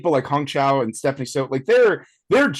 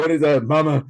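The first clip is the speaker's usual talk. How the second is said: [name]; faster than usual. Retold or imitated. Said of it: "he was turning it on".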